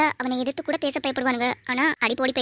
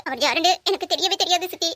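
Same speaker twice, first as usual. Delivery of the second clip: ordinary talk, nothing marked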